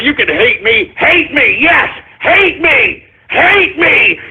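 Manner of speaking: ordinary talk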